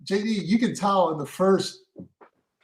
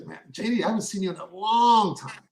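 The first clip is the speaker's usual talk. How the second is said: stretched out long. Shown as a demonstration. Actor demoing a reunion line to his cohosts, "long" stretched out. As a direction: stretch the word "long" like it's been ages. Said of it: "I stretch out long".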